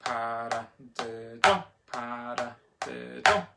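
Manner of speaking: paradiddle chanted slowly, last syllable punched; slow chant landing hard on the end of paradiddle; accent on fourth syllable. Shown as a demonstration. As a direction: hit the final syllable of each paradiddle. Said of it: "let's try paradiddle on four".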